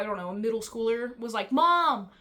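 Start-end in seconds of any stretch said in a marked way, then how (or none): 1.5-2.1 loud, higher mock yell of a kid; yelling across the room. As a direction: call out across the library like a middle schooler. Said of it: "like, yelling across".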